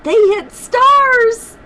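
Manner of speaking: stars held high and slow in amazement; excited emphasis. Shown as a demonstration. Parent stressing a story detail to a child, in a high, slow, amazed voice. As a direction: marvel at it, stretching out stars.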